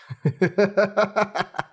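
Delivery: high crazed cackle; maniacal laugh. Acted. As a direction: laugh like a madman. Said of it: "Он безумно засмеялся". (безумный смех)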